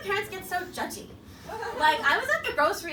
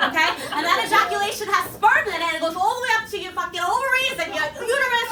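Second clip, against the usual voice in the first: mom giving the sex talk; 4 dB louder than usual. Retold or imitated. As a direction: play the blunt mom lecturing her eight-year-old. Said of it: "she was like".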